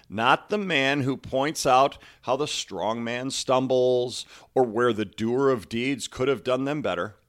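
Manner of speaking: slow, forceful recitation of a quote; emphatic quote reading. Acted. Coach reads listeners a famous quote, emphatic recitation. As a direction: declaim the quote with deliberate weight.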